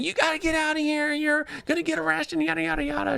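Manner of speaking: very high, shrill-pitched mock teacher voice; teacher ordering the mother out, very high mock rant. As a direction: squeak out the teacher's panic way up high. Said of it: "the teacher's just ranting and raving".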